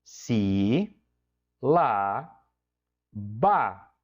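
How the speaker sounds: si, lá, ba spoken as three separate slow beats; syllable-by-syllable teacher demo; split into syllables. Shown as a demonstration. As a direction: break sílaba into its syllables, pausing between each. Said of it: "por exemplo, ó, eu tenho".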